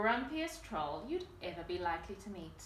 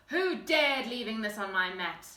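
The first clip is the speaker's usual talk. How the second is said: louder, growled troll grumble; a grumpy troll's louder growl; 8 dB louder than usual. A narrator's reading. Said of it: "growled the Grunt".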